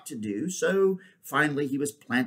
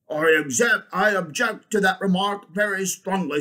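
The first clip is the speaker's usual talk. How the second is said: loud, high bulldog objection; indignant bulldog; 7 semitones higher, 6 dB louder than usual. Acted. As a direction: protest forcefully as the bulldog. Bulldog figure objects in story.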